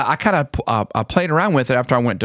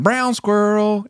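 much higher, slow sing-song brown squirrel; sing-song test; 10 semitones higher, slower than usual. Retold or imitated. Dad tests his daughters with the same tune, sing-song. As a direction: sing it in the love-you tune. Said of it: "same inflection and same tone".

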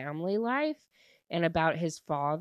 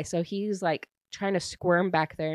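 ordinary delivery

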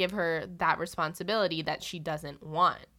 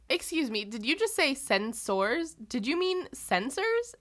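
higher, mock-incredulous correcting question; Mariner; 5 semitones higher than usual. Mariner interrupts the admiral's speech to correct him, mock incredulous. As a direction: imitate someone pointedly querying a pronunciation. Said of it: "Mariner could not help herself".

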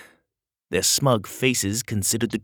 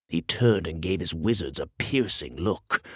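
ordinary delivery, nothing marked

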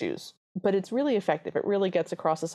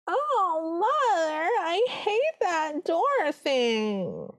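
very high, extremely drawn-out whine; exaggerated stepsister impression, every word stretched high; hammed-up high moan, sliding down at the very end; Arlene; 11 semitones higher, much slower than usual. Shown as a demonstration. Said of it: "the way that Arlene speaks is".

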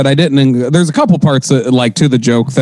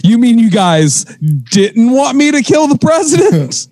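mock confused assassin; 8 semitones higher than usual.

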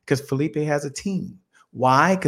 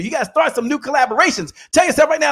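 rallying shout; 9 semitones higher than usual. Real, not acted. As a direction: fire up the crowd. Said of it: "rallying the audience".

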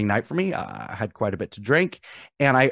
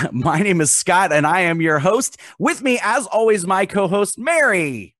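higher, bright announcer roll call; host introductions; 8 semitones higher, slower than usual. Acted. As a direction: present each co-host with a flourish.

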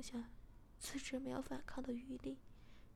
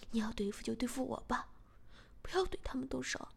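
quick, louder hurried begging; a touch of panic, rushed and pressing; frightened plea; 4 dB louder, faster than usual. Acted. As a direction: plead fast to protect the family. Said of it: "小贤马上害怕起来".

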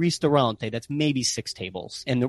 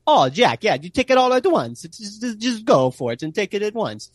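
mock Italian accent, much higher and louder; Italian friends urging; 10 semitones higher, 4 dB louder than usual. Italian friends urging Jack to down grappa, in a mock Italian accent. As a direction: egg him on in a hammed-up Italian accent. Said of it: "being Italian".

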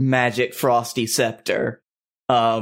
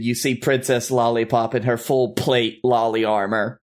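ordinary delivery

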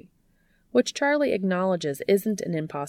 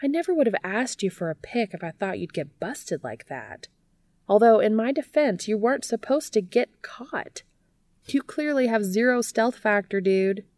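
teasing Charlie; 6 semitones higher than usual. A narrator's reading. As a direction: rib him, sympathy gone.

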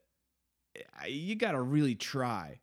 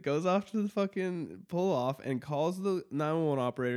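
usual speaking voice

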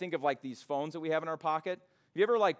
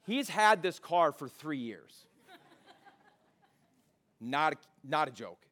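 ordinary talk, nothing marked

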